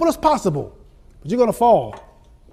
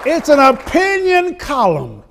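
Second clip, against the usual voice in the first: mock indignant; 6 semitones higher, 4 dB louder than usual. Retelling reply to editor.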